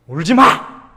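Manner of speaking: a sharp, loud scold. Retold or imitated. Old pastor scolding the weeping mourners, loud rebuke. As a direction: snap it like a stern rebuke. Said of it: "야단을 치시는 거예요".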